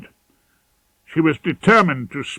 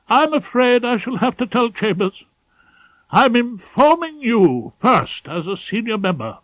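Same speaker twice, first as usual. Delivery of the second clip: much higher, prim and fretful; a nervous pompous senior barrister with urgent news; higher, prissy, anxious chambers head; fussy, pompous head of chambers; 8 semitones higher, 3 dB louder than usual. Acted. Ballard's voice.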